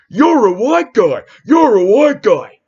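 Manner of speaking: high, loud, drawn-out jeering shout; mocking howl. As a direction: howl it out slowly in a put-on mocking voice.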